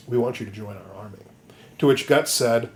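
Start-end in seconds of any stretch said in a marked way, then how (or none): 0.1-1.2 softer, lower, quick pitch; soft mock recruiter. Griffith courting Guts, soft. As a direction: murmur the offer.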